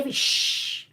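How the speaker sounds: a hushed "shh" with no voice; sh sound. Shown as a demonstration. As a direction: hiss "shh" softly like a digraph. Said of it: "fez um outro som diferente". (som "shh" sussurrado, sem voz)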